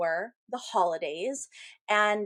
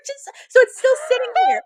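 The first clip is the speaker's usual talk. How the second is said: real laughter through high, loud words; laughing; 11 dB louder than usual. Real, not acted. Medium shows co-host the paper still there, laughing. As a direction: crack up while getting the words out.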